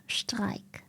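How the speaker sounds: Streik with a sh at the start; German pronunciation. Shown as a demonstration. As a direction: say Streik the German way, sh sound first. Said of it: "it's a sh".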